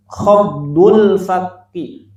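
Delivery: "Khafdul fakki" drawn out slowly, syllable by syllable; slow, clear term. Shown as a demonstration. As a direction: say "Khafdul fakki" slowly so the class can follow. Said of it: "Bagaimana cara bacanya". ("Khafdul fakki" diucapkan pelan dan jelas)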